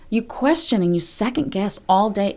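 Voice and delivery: ordinary delivery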